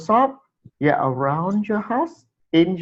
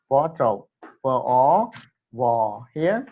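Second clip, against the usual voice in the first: ordinary delivery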